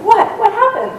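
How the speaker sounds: high, jolted mock alarm; startled wake-up. Guide jolted awake at night, high startled mock alarm. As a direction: imitate a man startled out of sleep.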